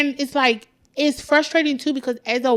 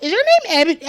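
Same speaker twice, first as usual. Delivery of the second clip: higher-pitched fan recognition; excited fan; 7 semitones higher, much faster than usual. Retold or imitated. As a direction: imitate a fan asking eagerly. Acting out fan recognizing her.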